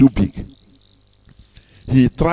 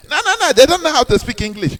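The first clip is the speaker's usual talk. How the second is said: mocking snob; 10 semitones higher, faster than usual. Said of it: "And you'll be having them".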